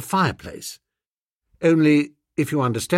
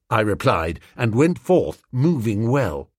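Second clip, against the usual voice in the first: usual speaking voice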